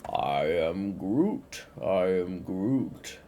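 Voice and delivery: low, slow, different Groot voice. A narrator's reading. Groot answering the jungle voices, low and slow. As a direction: rumble it out slowly like a tree creature. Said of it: "Groot said".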